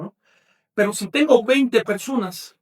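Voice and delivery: usual speaking voice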